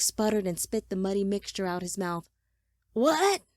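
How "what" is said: high, loud exclamation; startled shout. A narrator's reading. Man just roused from a daze, high startled shout. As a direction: shout it with a sharp jump in pitch. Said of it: "The shout startled him".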